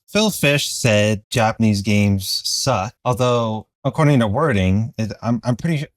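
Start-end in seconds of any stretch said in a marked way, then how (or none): none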